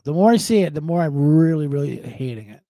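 ordinary talk, nothing marked

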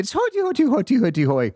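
cartoon Irish leprechaun accent; mock Irish accent. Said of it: "is the accent I was, you know, I was joking".

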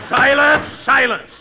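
loud, high furious bark; angry shout. Acted. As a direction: shout the court into silence. Judge shouts down the court.

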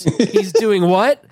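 high, stretched-out incredulous question; mock shocked disbelief. Sweet church girl hears something lewd, mock shock. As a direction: imitate a scandalized church girl, slowly.

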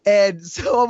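ordinary delivery

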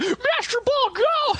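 put-on very high, excited kid's call; mock battle call. Host imagining a kid cutting off Mewtwo's speech, mock battle cry. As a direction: cry it out like a young trainer throwing.

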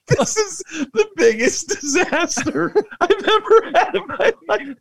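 high, cracking laugh through the words; giggling so hard the words wobble; laughing in disbelief. Retold or imitated. Dad at skee ball laughing at his daughter's wild throw. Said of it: "And I can't stop laughing".

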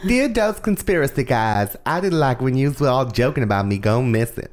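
high-pitched mock letter-writer voice; exaggerated high girl impression; imitated missing girl. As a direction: put on a high voice, reading a pretend complaint. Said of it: "I don't think she sounds like that".

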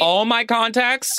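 high, incredulous mock question; comic outrage pitched right up; mock disbelief. Co-host appalled at the tagging app, mock disbelief.

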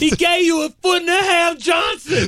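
slow, very high movie holler; shouted coach impression. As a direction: hammer it out slowly as a hollering coach. Acting out the coach's film speech.

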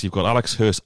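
normal talking voice

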